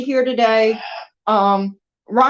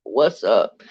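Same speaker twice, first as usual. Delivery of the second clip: low croaky parrot voice; imitating the bird; 14 semitones lower, 3 dB quieter than usual. Woman imitates her parrot, low croaky bird voice. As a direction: mimic the parrot, much lower. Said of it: "she's saying".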